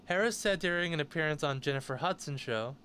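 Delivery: ordinary delivery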